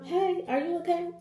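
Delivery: a gentle check-in from the boss; concerned supervisor. Retold or imitated. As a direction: supervisor asking the narrator if she's okay, lightly imitated. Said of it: "my supervisor was like".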